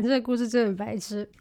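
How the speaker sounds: ordinary talk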